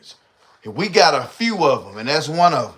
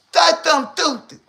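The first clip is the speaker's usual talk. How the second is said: imitating her; 13 semitones higher than usual.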